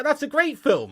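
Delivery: ordinary talk, nothing marked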